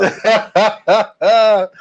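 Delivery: loud, high burst of laughter; real hearty chuckle; amused laugh. Real, not acted. Reacting to a co-host's teasing.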